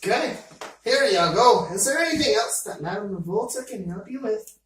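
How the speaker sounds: put-on cheerful shopkeeper, Scottish accent. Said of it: "I'm going into a Scottish accent again".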